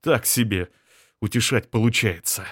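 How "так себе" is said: plain reading voice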